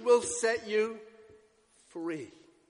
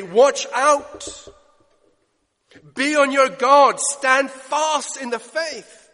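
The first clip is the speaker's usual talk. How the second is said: louder and higher, stern alarm; urgent warning; 6 semitones higher, 8 dB louder, much slower than usual. Acted. Paul warning the Corinthian church, loud urgent command.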